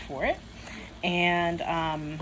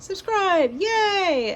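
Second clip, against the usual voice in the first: excited; 12 semitones higher, 6 dB louder, much slower than usual. Real, not acted. Cheerful vlog sign-off call.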